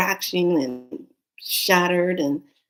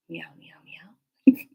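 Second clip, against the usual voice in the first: whispered meow; slower than usual. Retold or imitated. Imitating the cat's answer, whispered meows. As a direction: whisper like a little cat.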